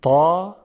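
تا said thick and heavy. Shown as a demonstration. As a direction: voice the emphatic تا full and dark.

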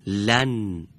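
ordinary talk

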